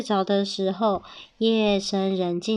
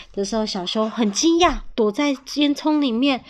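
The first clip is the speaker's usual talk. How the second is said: usual speaking voice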